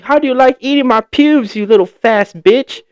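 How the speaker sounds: very high, loud, cartoonish taunting cackle; mocking bully laugh. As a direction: ham up the bully jeering at him. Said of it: "And he just like".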